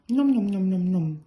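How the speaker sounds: happy greedy munching noises; munching mouthful. A narrator's reading. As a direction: chew it out loud with a full mouth. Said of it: "through a particularly tasteful mouthful of mince pies".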